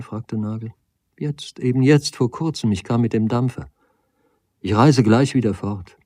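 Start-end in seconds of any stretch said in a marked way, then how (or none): 1.2-6.0 lightly voiced agitated lady, a little higher; hint of a flustered lady visitor. Lady answers Nagel about her arrival, mildly agitated. Lady's agitated dialogue in story.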